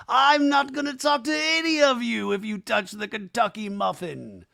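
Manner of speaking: high, mocking Lisa voice; imitating Lisa. Host mocking Lisa's feud with the cast, in a high voice. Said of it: "She's like".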